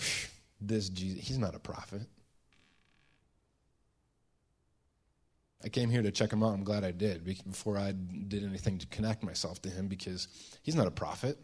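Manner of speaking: low, quiet, dismissive muttering to himself; Simon. Acted. Pharisee privately judging Jesus at dinner, low dismissive mutter. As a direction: think it aloud, scoffing under the breath. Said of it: "the inner dialogue of Simon".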